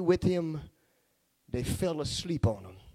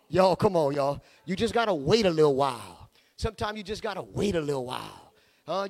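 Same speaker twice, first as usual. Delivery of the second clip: ordinary talk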